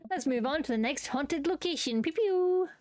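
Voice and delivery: campy ghost-tour showman; flustered tour guide. Acted. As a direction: regain the group with forced showmanship. Flustered guide moves group along.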